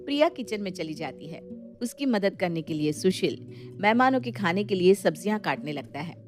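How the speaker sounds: ordinary talk, nothing marked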